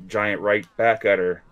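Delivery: ordinary delivery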